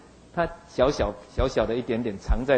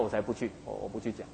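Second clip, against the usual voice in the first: sulky refusal; 5 semitones lower, 11 dB quieter than usual. Retold or imitated. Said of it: "所以约拿说：".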